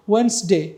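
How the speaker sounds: ওয়েডনেসডে said as "WENZ-day", silent D; silent-D pronunciation. Shown as a demonstration. Teacher giving an English example of silent letters, correctly pronounced. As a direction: say ওয়েডনেসডে in two syllables, "wens-de". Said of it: "যেমন আমরা বলি".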